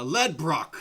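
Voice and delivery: loud, high announcer's name call; Mordecai. Acted. Announcer finishes the fighter's name, loud and projected. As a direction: punch the surname out to the arena. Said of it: "speak extra boomingly loud to everyone".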